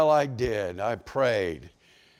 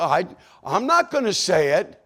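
higher, louder protest; insistent refusal; 5 semitones higher, 6 dB louder than usual. Retold or imitated. Churchgoer telling God he won't repeat it, insistent. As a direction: dig in and refuse, voice raised.

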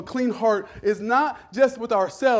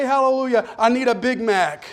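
louder and higher, hammed-up preacher cry; mock pulpit shout; 5 semitones higher, 5 dB louder than usual. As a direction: slam the pulpit in jest. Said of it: "slam the pulpit and say".

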